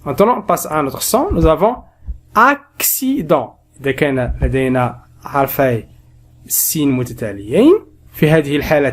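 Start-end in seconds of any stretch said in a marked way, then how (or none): 2.3-3.6 accident with cc as k then s, drawn out; slow syllable demo. Shown as a demonstration. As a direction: say ak-si-dent slowly. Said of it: "الأول ينطق بالكاف والثاني ينطق بالسين".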